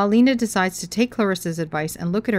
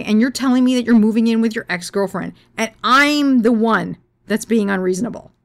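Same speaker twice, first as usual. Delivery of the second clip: furious rant; 4 semitones higher, 5 dB louder than usual. Retold or imitated. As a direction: rage in disbelief. Furious rant after his reasonable plea.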